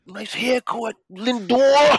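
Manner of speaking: high, loud Boston heckle; Boston accent. Retold or imitated. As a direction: call out like a Boston fan. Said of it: "you had the Boston accent".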